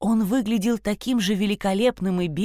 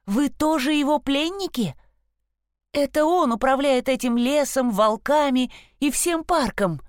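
put-on higher, insistent girl's voice; urgent girl voice; 6 semitones higher than usual. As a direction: press the question urgently. Sasha questions the stag.

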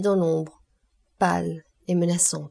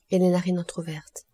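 usual speaking voice

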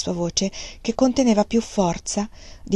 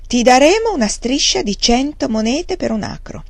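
hard, cold, louder offer; harsh; 5 semitones higher, 4 dB louder than usual. Acted. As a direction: lay down the price harshly. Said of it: "fece una pausa e poi disse con durezza". (con durezza, tono duro e freddo)

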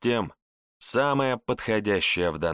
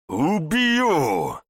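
high, loud furious roar; enraged roar; 8 semitones higher, 5 dB louder than usual. A narrator's reading. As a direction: roar the threat like a madman. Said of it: "взревел обезумевший Верзила".

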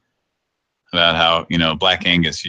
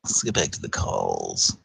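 much lower and softer mock radio voice; deep announcer voice; 13 semitones lower, 8 dB quieter than usual. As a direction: drop into a low radio-host voice. Repeats line in put-on voice.

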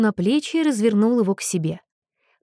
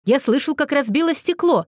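higher, insistent call; 6 semitones higher than usual. A narrator's reading. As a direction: call it through a closed door. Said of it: "крикнул Уилл".

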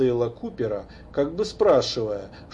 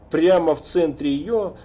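usual speaking voice

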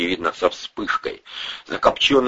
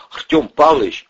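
higher-pitched, tentative plea; timid appeal; 9 semitones higher, 4 dB louder than usual. A narrator's reading. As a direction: open hesitantly with the boss. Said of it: "робко начал Андрей".

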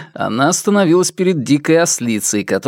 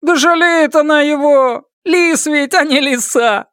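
bawdy giggle; 13 semitones higher than usual. A narrator's reading. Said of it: "скабрёзно хихикнула".

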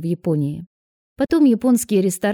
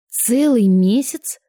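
slow, higher astonished question; 4 semitones higher, 4 dB louder, slower than usual. A narrator's reading. Woman hears how long since he visited grandmother, astonished. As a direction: stretch it out in amazement. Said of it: "поразилась она".

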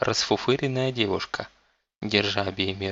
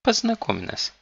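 whiny spoiled pouty drawl; 13 semitones higher than usual. A narrator's reading. Spoiled girl character speaks.

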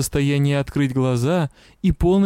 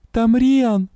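high-pitched, anguished moan; moaning plea; 6 semitones higher, 4 dB louder than usual. A narrator's reading. Patient telling the witch who is there, anguished moan. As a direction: cry it out as a pained, raised moan. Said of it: "простонала я".

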